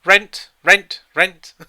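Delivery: hammed-up greedy landlord queen; demanding rent. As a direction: bark for the rent.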